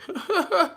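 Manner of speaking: squeaky high laugh; high burst of laughter. Real, not acted. Cracking up at a viewer comment.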